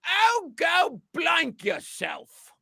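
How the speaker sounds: slow, high, shouted curse; loud brutal tone. Acted. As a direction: bark it out brutally. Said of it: "in a loud, brutal tone".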